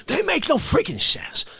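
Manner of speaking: ordinary talk, nothing marked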